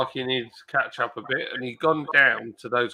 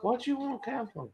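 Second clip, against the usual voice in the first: cat voice; 10 semitones higher, 10 dB quieter than usual. Real, not acted. Talking to the cat in baby voice.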